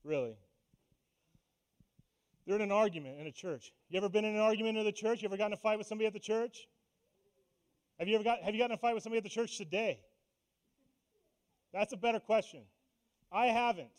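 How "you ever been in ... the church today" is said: rapid-fire questions. Real, not acted.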